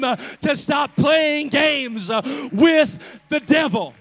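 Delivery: loud, shouted preaching with rhythmic uh; full-force preaching. Acted. Preacher warning his congregation against the devil, shouting. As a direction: hammer the warning home to the congregation.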